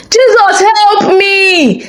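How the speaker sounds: a high, desperate cry with a catch in it; shouted cry for help. A narrator's reading. Fleeing girl shouts to Jesus for help in the dark street, high and desperate. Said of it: "she shouted".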